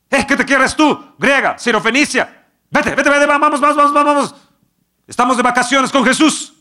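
loud, high dismissive shooing; disciples shooing her. Acted. Disciples chasing the woman off, impatient loud shooing. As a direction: brush the pest off, impatient and loud.

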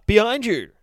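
high, loud urgent cry; shouted warning. Acted. Narrator tries to warn Mister Buttons, high shout. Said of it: "because he's trying to warn him".

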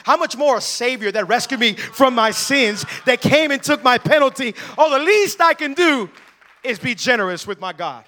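preaching at full force. Acted. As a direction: rouse the church with each line.

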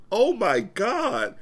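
high, loud, drawn-out disbelief; retold shock. Book fan reliving the death scene to a friend, shocked. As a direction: stretch it out as a shocked reader. Retells shocked reaction to reading.

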